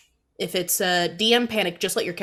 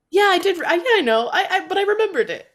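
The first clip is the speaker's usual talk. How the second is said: mock flustered self; 11 semitones higher, 5 dB louder than usual. Mocking a forgetful self.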